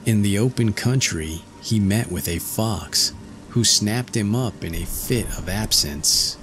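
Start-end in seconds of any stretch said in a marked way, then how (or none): none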